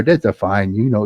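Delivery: ordinary delivery, nothing marked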